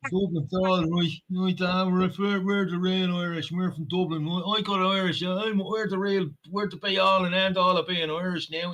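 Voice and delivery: lightly put-on Dublin accent; mock Dublin accent. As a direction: swagger as a proud Dubliner. Parodying Dubliner.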